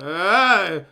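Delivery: wordless swelling "aah" of frustration; frustrated yell. Real, not acted. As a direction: let out a rising cry of exasperation. Frustrated while typing on the Switch.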